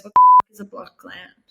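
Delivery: loud, disbelieving swear; incredulous outburst. Retold or imitated. GP scoffing at the blocked gland diagnosis, loud and incredulous. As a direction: blurt it out, stunned at the excuse. GP's incredulous retort, retold.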